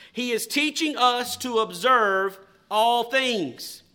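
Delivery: said slowly, word by word; slow dictation. Shown as a demonstration. Preacher reading slowly for notes to be written, slow dictation. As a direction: dictate it so they can write it down. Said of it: "I will read them slowly".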